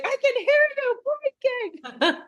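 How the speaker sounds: high, slow, cracking with laughter; laughing mock. Wife teasing her husband about his blinking, laughing high. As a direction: laugh through the line.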